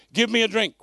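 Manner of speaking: loud, commanding request for a drink; curt, rude-sounding demand; blunt demand. Shown as a demonstration. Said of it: "It doesn't read as polite in the King James".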